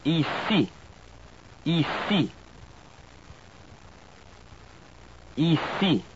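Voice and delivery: "Ici" with final syllable stressed, both i's pure; French pronunciation. Shown as a demonstration. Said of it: "the last syllable is always stressed".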